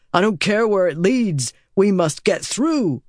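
a hint of a firm soldier; urgent rider. A narrator's reading. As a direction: press her to go now. Rider urges escape in chaos.

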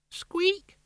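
very high, soft squeaky voice; tiny squeaky mouse. Acted. Rat ghost squeaking.

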